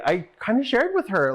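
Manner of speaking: normal talking voice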